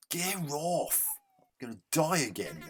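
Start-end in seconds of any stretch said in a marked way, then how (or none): 0.1-1.1 high, sharp angry yell at the screen; frustrated yell. Real, not acted. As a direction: snap it out at the game. Frustrated gamer yells at enemy.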